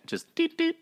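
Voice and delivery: high, soft horn imitation; tiny horn toot. Cohost boasting a teeny bit, tiny toot. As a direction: toot a little horn with your voice. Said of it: "I'm gonna toot my horn".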